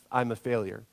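the harsh self-talk of failure, pitched low; inner critic voice. Retold or imitated. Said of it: "we begin to believe".

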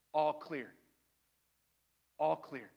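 low, soft all-clear; gentle reassurance. Acted. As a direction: say it quietly as God comforting someone. God gently says all clear.

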